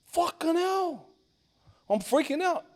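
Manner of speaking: high, stretched-out dismay; high-pitched, appalled exclamation; re-enacted freak-out. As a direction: act out alarm without raising the volume. Retelling panic at friend's wound.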